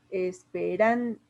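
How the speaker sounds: ESPERAN lento, por sílabas, hasta llegar a RAN; vocal acentuada. Shown as a demonstration. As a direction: separa ES-PE-RAN para señalar la A tónica. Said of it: "la vocal acentuada de manera prosódica". (silabeo lento de ESPERAN)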